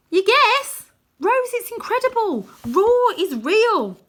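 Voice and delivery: high and loud, last words drawn out slowly; bursting with wonder; boy thrilled, pleading with his sister; excited. A narrator's reading. Arthur thrilled by the fantasy world.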